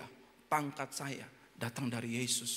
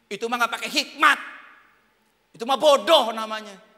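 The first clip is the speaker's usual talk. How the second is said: loud scornful jeer, Christians sneering; mocking scorn; 7 semitones higher, 15 dB louder than usual. Preacher voicing scornful Christians, a loud mocking jeer. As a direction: sneer it loudly at the fool.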